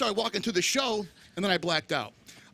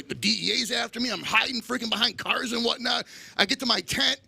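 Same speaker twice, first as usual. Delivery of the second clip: usual speaking voice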